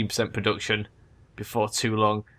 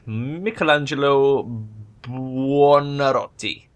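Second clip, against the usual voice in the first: slow, hammed-up mock Italian; pseudo-Italian accent; 2 semitones higher, much slower than usual. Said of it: "in a sort of pseudo-Italian accent".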